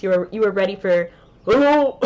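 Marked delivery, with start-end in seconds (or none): 1.4-2.0 high squeaky little bug greeting. Retold or imitated. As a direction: chirp it like a tiny insect. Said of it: "impression of a bug voice".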